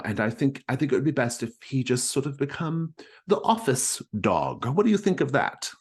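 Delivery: high, fretful society widow; a put-upon widow pleading, pitched well up; Mrs Stackpole voice. Acted. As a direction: GM voicing the fussy widow to Rushmore. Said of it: "she's saying".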